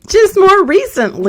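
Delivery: very high, loud, drawn-out comic wail; hammed-up blubbering, stretching the words; mock crying. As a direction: imitate tearfully squeaking out an excuse. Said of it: "I start crying on the phone".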